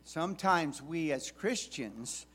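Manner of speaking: ordinary talk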